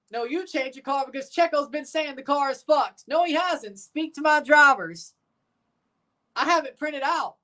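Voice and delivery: high-pitched mock argument; mock bickering. Acted quarrel between team bosses.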